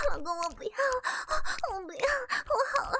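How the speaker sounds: whimpering, breaking up; whimpering plea. Acted. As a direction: plead between sobs of breath. Wife begging, overwhelmed. (带着哭腔求饶)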